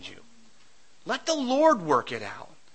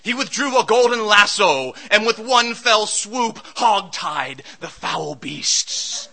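hammed-up epic narration, loud in places, slowing at the end; mock dramatic storyteller; 2 semitones lower, 5 dB louder than usual. Preacher imagining a flashy account of the lions' den, hammed-up. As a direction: tell it like an overblown legend. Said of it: "I would want to tell people what happened".

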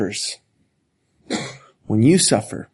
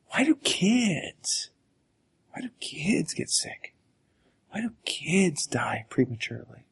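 hushed, breathy whisper of grief; 10 dB quieter than usual. Real, not acted. Pastor whispering grief to the congregation. Grieving questions about dying children.

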